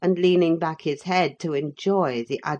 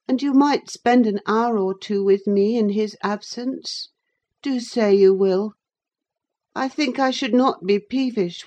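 put-on raised, pleading boy's voice; coaxing boy Linton; 5 semitones higher, 4 dB louder than usual. A narrator's reading. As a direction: coax your cousin to keep you company.

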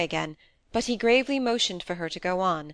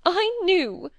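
high, louder acted tearful wail; through sobs; 13 semitones higher, 5 dB louder than usual. A narrator's reading. Wife answers husband's temper, acted sobbing. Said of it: "through sobs and tears".

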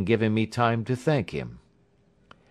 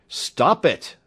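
loud, raised-pitch command; sharp cry; 8 semitones higher, 6 dB louder than usual. A narrator's reading. Doctor trying to halt the patient's hysterical laughter, crying out. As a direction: cry out to check his hysterics. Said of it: "I cried".

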